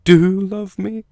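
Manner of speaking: very high, slow, breathless disbelief; a girl whose dream comes true; starry-eyed Sansa. Retold or imitated.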